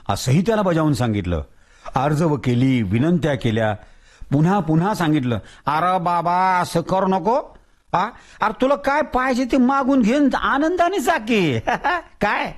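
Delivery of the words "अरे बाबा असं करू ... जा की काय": higher, coaxing with a laugh; pleading Tatya. Acted. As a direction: plead with him gently.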